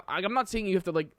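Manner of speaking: ordinary delivery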